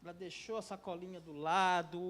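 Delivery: ordinary delivery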